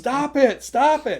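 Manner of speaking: high, loud mock yell; exasperated protest. Teased vendor to the joking actor, loud protest.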